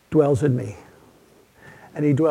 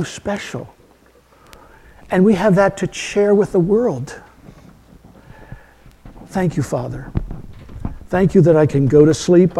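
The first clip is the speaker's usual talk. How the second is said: fervent pastoral prayer, rising then falling to a hush; earnest prayer; 3 dB louder than usual. Acted. Pastor prays over the church to close the sermon, fervent then hushed. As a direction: pray over the congregation, heartfelt.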